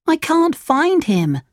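helpless little puppet, high and drawn out; Pinocchio, upset. A narrator's reading. Pinocchio cannot find his father, upset high voice.

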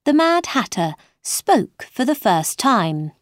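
ordinary delivery

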